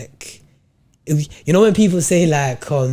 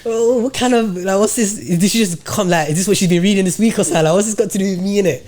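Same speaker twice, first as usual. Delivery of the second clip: incredulous; 6 semitones higher than usual. Retold or imitated. Incredulous retold inner thoughts.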